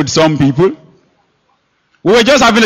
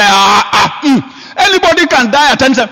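fast, laughing dismissal; scoffing laugh; 7 semitones higher than usual. Retold or imitated. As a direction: laugh it off quickly. Scoffing unbelievers, laugh heard.

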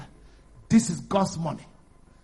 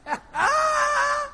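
high-pitched, held laughing whoop; sustained high laugh; high drawn-out laugh. Real, not acted. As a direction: let out a long high cackle. Preacher laughs at his point.

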